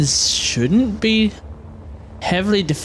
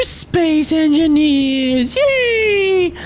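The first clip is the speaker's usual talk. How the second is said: high, drawn-out happy cheer; excited cheer; 10 semitones higher, 4 dB louder, much slower than usual. Real, not acted. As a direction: cheer like the intro's a party.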